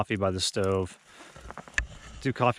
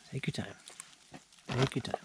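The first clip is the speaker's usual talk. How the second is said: reassuring the dog; 11 dB quieter than usual. Real, not acted.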